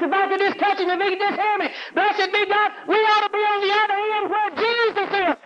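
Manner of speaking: high, ringing preacher's call; full-force preaching. Acted. Preacher urging his congregation to transfer, full-force preaching. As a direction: lift it and drive it home to the church.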